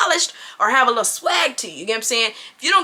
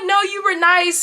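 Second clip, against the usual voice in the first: light reassuring; 6 semitones higher than usual. Retold or imitated. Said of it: "I'm like".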